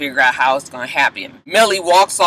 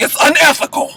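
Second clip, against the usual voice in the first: indignant; 9 semitones higher than usual. Retold or imitated. Fitz confronts Abby, retold.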